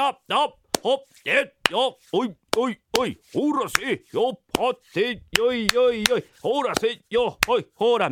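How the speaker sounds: high, lively heave-ho grunts; rhythmic work calls. Pretend. Husband resuming fake pounding on wife, work calls. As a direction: keep the pounding beat with calls.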